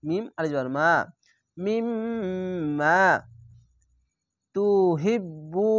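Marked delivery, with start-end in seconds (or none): 1.6-3.0 মিম্মা with a long held nasal mm; Quranic recitation. Shown as a demonstration. Teacher recites the word slowly for students. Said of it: "ওয়াজিব গুণনা". 4.5-5.7 তুহিব্বুন with the final un stretched long; Quranic recitation. Shown as a demonstration. Teacher recites the word slowly for students. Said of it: "তিন আলিফ টেনে পড়বেন".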